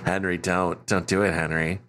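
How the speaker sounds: a bored extra saying his one line; flat deadpan. Retold or imitated. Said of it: "his delivery is so flat".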